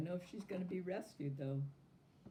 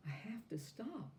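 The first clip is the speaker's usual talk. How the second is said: tired and soft; 6 semitones higher, 6 dB quieter than usual. A narrator's reading. Tired girl pleads.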